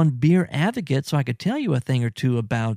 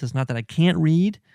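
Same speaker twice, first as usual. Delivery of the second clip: usual speaking voice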